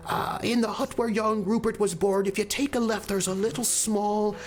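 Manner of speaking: put-on high, creaky old countrywoman rambling; old woman voice. Old woman in the pub corner gives rambling directions, high old voice. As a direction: drift along like a chatty old villager. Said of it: "And, and she's like, it's this, like, wandering".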